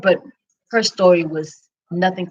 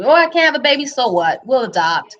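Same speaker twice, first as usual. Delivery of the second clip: breezy dismissal; 6 semitones higher than usual. Retold or imitated. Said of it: "I didn't think I would be that woman".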